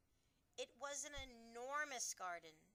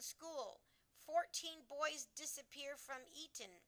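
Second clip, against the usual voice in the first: higher, louder shocked reading; alarmed Queen reading; 6 semitones higher, 7 dB louder than usual. A narrator's reading. Queen reading the newspaper aloud, alarmed. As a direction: read the headlines aghast.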